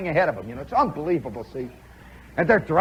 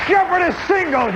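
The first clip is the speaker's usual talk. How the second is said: higher, louder triumphant repeat; excited call again; 8 semitones higher, 4 dB louder than usual. Retold or imitated. Retelling his hit, higher triumphant shouted repeat. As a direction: savor it, calling it out once more.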